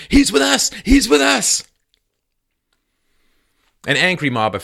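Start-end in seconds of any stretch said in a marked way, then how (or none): 0.1-1.6 high, loud mock shout; imitated shout. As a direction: shout to call off the beating.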